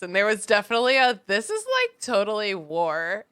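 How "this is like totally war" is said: put-on Valley Girl voice. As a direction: play a ditzy Valley Girl. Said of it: "in Valley Girl voices".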